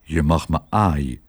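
low, sheepish dog voice; subdued, bashful concession; a bit ashamed. A narrator's reading. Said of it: "Een beetje beschaamd".

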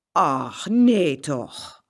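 loud, drawn-out wonder; amazed exclamation. A narrator's reading. Farmer's wife hearing they are twins, amazed exclamation. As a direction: linger on it in amazement.

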